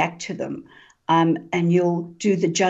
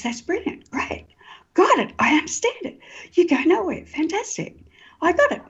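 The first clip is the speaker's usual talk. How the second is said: much higher, bright-eyed rush of mock understanding; eager student thinking; 12 semitones higher than usual. Student to himself, sure he understands Buddha, excited high voice. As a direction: play the student who thinks he's got it. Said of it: "And the student thinks".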